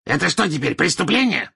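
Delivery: high, indignant character voice; cantankerous wizard, rising in outrage; belligerent old wizard. Acted. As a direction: challenge the official, pitch climbing.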